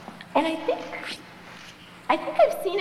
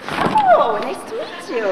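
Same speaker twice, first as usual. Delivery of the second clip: much higher oh, sugary and insincere; fake delight; 8 semitones higher, 6 dB louder, slower than usual. Snobby roommate reading her roommate's letter, fake delight. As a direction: pretend to be charmed.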